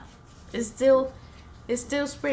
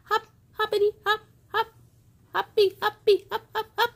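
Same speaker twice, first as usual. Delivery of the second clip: high, bouncy frog voice; hopping frog; 11 semitones higher, 4 dB louder, faster than usual. Acted. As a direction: hop every word like a little frog.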